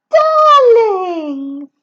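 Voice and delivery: high, loud, adoring darling; gushing fiancée. Acted. Helen gushing to her fiancé about flowers, high and adoring. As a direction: coo it at your sweetheart.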